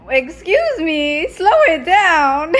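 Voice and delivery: slow, loud, laughing mock-stern telling-off; playful scolding. Real, not acted. Mother telling child to slow eating.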